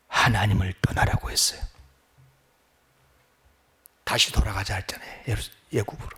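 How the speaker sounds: very low, soft, near-whispered sermon; hushed preaching. Acted. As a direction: drop right down and leave long pauses.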